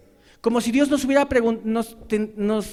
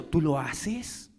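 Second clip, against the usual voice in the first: very slow, lower turning of the tables; slow rebuke; 5 semitones lower, 4 dB quieter, much slower than usual. Retold or imitated. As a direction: say it slowly and pointedly, lower. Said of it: "demanda algo que no hace".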